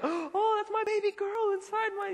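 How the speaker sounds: high-pitched mock gasp of delight; gushing pregnant cousin. Pregnant cousin gushing at her ultrasound, hammed-up high gushing. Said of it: "where's my cousin's like".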